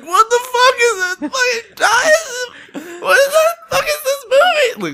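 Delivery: very high, very slow wailing through laughter; laughing fake sob. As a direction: bawl it out half laughing. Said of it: "I'm just like".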